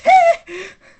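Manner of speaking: sudden high-pitched scream; piercing, very loud squeal. Real, not acted. Vlogger startling his own viewers, sudden loud scream. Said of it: "pour ce cri, c'était tellement fort".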